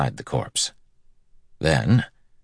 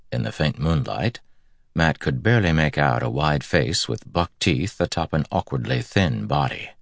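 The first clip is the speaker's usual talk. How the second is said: ordinary delivery, nothing marked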